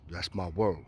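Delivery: low, soft assurance; quiet promise. Retold or imitated. Promising the neighbor through the vent, quiet and low. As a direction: swear it under your breath.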